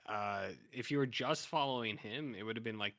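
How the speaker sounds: usual speaking voice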